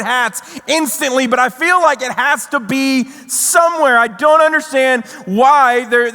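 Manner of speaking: high-pitched, worked-up incredulous rant; exasperated disbelief. Retold or imitated. Traveler baffled that nobody will find his hat, exasperated. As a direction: plead your case, baffled and exasperated.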